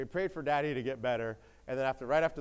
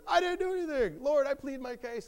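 very high, loud mock wail of innocence; desperate protest; 6 dB louder than usual. Job insists to friends and Lord, high desperate protest. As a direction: plead the case at the top of the range. Job's escalated protest in retold argument.

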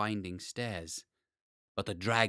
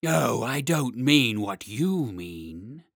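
very slow, heavy dragon; 6 dB louder, much slower than usual. Acted. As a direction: drag each word out like a great beast.

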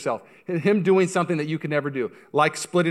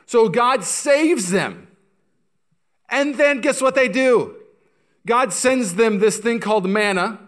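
high, loud, drawn-out preaching; emphatic preaching; 9 semitones higher, 7 dB louder, slower than usual. Real, not acted. Preacher tells how God saves Israel, loud emphatic preaching. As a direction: proclaim it slowly at full voice.

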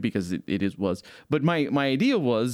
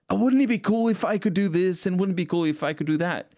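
put-on excited kid; 8 semitones higher than usual. Kids imagining cool features.

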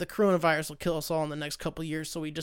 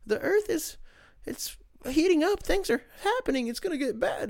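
much higher, whiny alarmed voice; high mock-frantic worrier; mock panic; 10 semitones higher than usual. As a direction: play the fretting alarmist, hammed up. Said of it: "we're all worried about".

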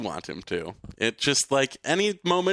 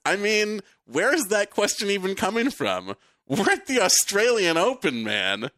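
higher-pitched, exasperated comeback; imitated incredulity; 7 semitones higher than usual. Host reading the player's reply to a reporter, mock incredulous. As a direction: read it as a fed-up challenge to the questioner.

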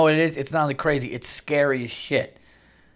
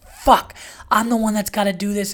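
retold angry yell; 8 semitones higher than usual. Man fed up with chasing his escaped dog, angry retold yell. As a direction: re-enact yelling in exasperation at having to do it. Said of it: "and I start yelling".

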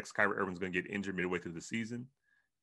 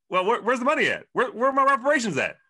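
mock indignant shout; 14 semitones higher, 11 dB louder than usual. Mock demand for reparations.

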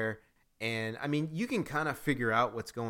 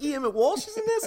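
high, loud, thrilled gasp of disbelief; can't believe he's in it, squeal it out; amazed disbelief; 6 dB louder than usual. Retold or imitated. Retold shock at seeing his name.

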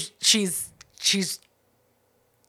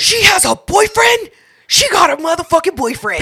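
outraged disbelief; 6 semitones higher than usual. Real, not acted. Listener hearing the twist about the boyfriend, incredulous shout. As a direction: cry out in scandalized astonishment.

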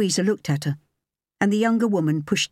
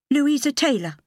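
higher, lighter woman's voice; young woman voice; 10 semitones higher than usual. A narrator's reading. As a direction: voice her higher and clear.

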